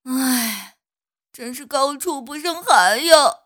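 sighing, rueful flower; heavy sigh. Acted. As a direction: sigh up at the sky. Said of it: "对天长叹".

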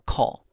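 口 as "khó", open o; Hokkien reading. Shown as a demonstration. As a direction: say 口 as Hokkien "kho". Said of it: "就念成口".

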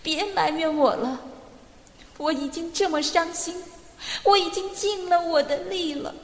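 high, tearful pleading; crying. A narrator's reading. Louisa confessing her lonely fear to father-in-law, crying. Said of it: "路易萨哭得更厉害了".

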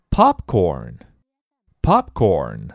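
"Popcorn" stretched very slowly, low and loud; slow model word. Shown as a demonstration. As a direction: draw out each "Popcorn". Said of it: "teacher models new word".

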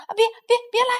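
loud, high panicked squawk. A narrator's reading. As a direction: squawk to keep them away. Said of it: "他呱呱叫道". (惊慌地呱呱大叫)

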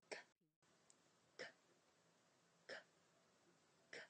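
unvoiced breathy k bursts; whispered k. Shown as a demonstration. 老师示范送气清辅音k，不出声. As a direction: push air, no voice, on k. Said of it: "我们来发一遍".

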